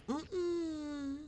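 high, hushed mm-mm; imitated refusal. Caller imitating Lucky turning her down, imitated refusal. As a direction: mimic a soft, high mm-mm of refusal. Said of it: "he was like".

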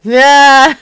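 loud yeah into a burst of laughter; loud laughing. Real, not acted. Laughing hard after the prank call line. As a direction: crack up loudly.

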